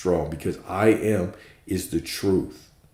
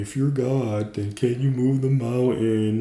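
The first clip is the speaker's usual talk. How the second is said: mocking skeptic; 4 semitones higher than usual. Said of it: "gonna be like".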